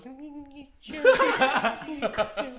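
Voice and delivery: high-pitched mimicry of her, with laughter; imitated girl. Imitating the Spanish girl offered a shot, high voice. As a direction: squeak it out as her reply. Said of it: "And she goes".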